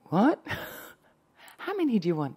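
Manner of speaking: high, loud bewildered "What?" breaking into a laugh; startled question with a laugh; confused surprise. Retold or imitated. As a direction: replay your own baffled reaction. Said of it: "And I said".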